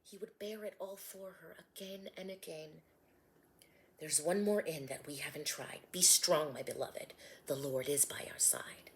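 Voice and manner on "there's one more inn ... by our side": Joseph comforting. Acted. Joseph comforting Mary in pain.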